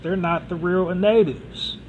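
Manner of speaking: ordinary delivery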